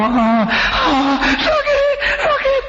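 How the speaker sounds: high pained wail of a patient; crying out in pain. Acted. Patient in hospital bed wailing in pain to nurse. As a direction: cry out like a hurting patient.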